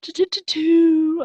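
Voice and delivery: high, loud sing-song chant; playful sing-song. Pretend. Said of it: "I'll stop".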